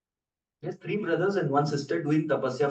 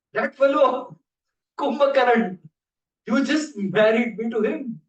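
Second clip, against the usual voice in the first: higher, louder tearful complaint; upset goddess; 8 semitones higher, 5 dB louder than usual. Acted. Nidra Devi complains to Brahma, higher and louder. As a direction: complain as if wronged.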